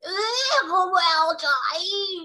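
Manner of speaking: high, loud, disgusted giant roar. Acted. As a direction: spit it out in revulsion. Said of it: "roared the Bloodbottler".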